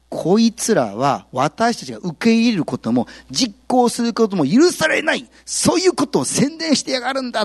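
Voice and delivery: angry slave owner. Retold or imitated. Slave owner denounces the apostles in a high angry rant. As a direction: spit out the accusation in contempt. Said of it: "この人が言うには".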